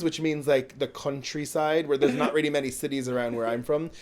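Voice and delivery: normal talking voice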